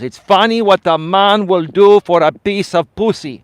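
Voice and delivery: loud, slow Greek dad impression; thick Greek accent. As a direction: imitate a Greek father, high and drawn out. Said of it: "with his thick Greek accent".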